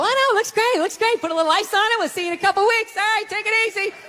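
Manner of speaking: high, breezy send-off; falsely reassuring surgeon. Acted. Surgeon lying to a patient after surgery, falsely cheerful. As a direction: reassure them brightly and hurry them out.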